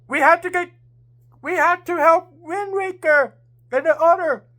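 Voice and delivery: rally the others to help; urgent shout. A narrator's reading. Rain shouting to everyone to help their friends. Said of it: "Rain, shout!".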